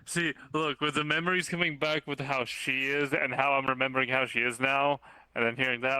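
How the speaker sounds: loud, insistent outpouring; forceful, loud explanation; insistent explaining. Acted. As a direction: raise your voice to make them understand. Flustered explanation after being questioned.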